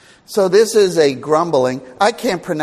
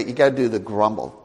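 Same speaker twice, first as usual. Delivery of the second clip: normal talking voice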